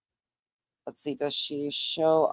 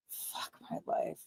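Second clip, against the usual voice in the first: muttered despair; 17 dB quieter than usual. Real, not acted. Muttered in despair at fraud news.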